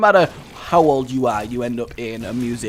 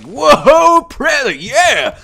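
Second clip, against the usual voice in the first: much higher and louder, over-the-top joy; ecstatic kid; 12 semitones higher, 11 dB louder, much slower than usual. Retold or imitated. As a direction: shriek it like a seven-year-old opening a huge box. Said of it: "and I was like".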